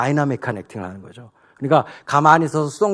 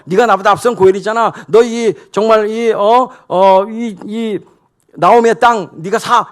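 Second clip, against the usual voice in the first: higher and louder, stammering insistence; pressing hard; 6 semitones higher, 5 dB louder than usual. Acted. Boaz cornering the nearer redeemer, loud and pressing. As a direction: corner him, don't let go. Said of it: "이러면서 막 몰아붙이니까".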